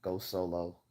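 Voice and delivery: ordinary talk, nothing marked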